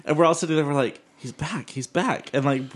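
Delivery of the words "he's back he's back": soft, hushed alert; hushed warning. Retold or imitated. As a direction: warn quietly so he won't hear. Friends warning quietly.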